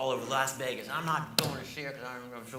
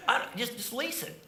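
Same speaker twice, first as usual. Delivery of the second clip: higher, slow "just lease it" through a laugh; imitating Carrot Top; 9 semitones higher, slower than usual. Comic imitates Carrot Top on jet leasing, high and slow. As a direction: play it as a sheepish excuse.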